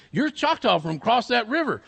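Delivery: lightly acted big fellow; big man's voice. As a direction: state it loudly to a small child. Big man questions girl.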